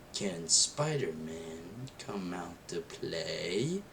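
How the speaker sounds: low, slow, soft villain taunt; creepy villain voice. Retold or imitated. Kid imitating the Green Goblin, low and slow. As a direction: drag the line out low like a lurking villain.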